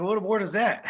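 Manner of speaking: usual speaking voice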